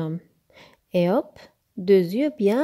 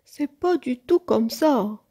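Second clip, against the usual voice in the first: raised, loud objection; high, emphatic storybook protest; insistent grumbling; 5 semitones higher, 5 dB louder than usual. A narrator's reading. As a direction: keep insisting crossly. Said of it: "répétait-il".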